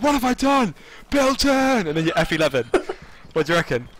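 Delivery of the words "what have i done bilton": high, loud mock cry of remorse; overacted despair over a fallen friend; anguished shout. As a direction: yell it like a hammed-up tragic hero. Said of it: "And then you're like".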